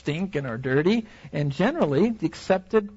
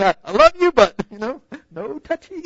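high, playful backing off; mock squeamish; 5 semitones higher, 6 dB louder than usual. Preacher pretending to push the woman away, mock squeamish. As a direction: sweetly refuse the touch. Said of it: "I'd be like".

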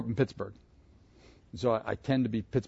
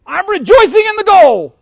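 loud, high joyful shout, much louder; 17 dB louder than usual. Acted. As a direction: burst out with the joy of the goal. Reliving the goal, shouting.

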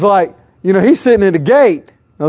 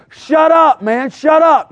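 crowd shouting down; 10 semitones higher, 3 dB louder, slower than usual. Retold or imitated. Crowd silencing beggar.

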